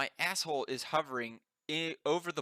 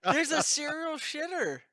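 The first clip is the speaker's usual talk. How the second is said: amazed exclaim; 13 semitones higher, 6 dB louder than usual. Real, not acted. Shocked friends react loudly.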